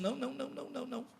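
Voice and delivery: hushed, repeated dismissal; quick string of soft little refusals. Acted. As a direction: wave it away very softly. Jesus declining the crowd's demand.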